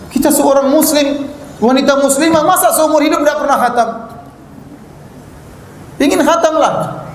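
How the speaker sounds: seruan berapi-api. Acted. Menegur muslimah yang tak khatam.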